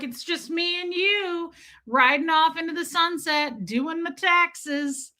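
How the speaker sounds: high-pitched mock-romantic gushing; hammed-up chumminess. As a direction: draw it out dreamily, slowing at the end. Hamming up a friendship with accountant.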